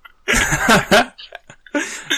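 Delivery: high, loud laughing fit; sudden high-pitched cackle of amusement; burst of laughter. Real, not acted. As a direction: crack up out loud. Cracks up at the banter.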